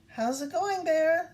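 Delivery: nervous, rising greeting, voice lifting on Bear; a touch of nervous politeness; a little nervous. Acted. Moose greets an approaching bear, a little nervous. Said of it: "a little nervously".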